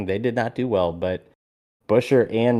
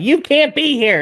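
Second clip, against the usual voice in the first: mock security guard; 4 dB louder than usual. Security guard quoted stopping him.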